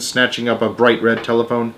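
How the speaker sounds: ordinary delivery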